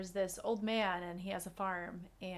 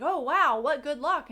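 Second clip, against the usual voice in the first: excited townspeople; 4 semitones higher, 10 dB louder than usual. Retold or imitated. Townspeople voiced in a parable.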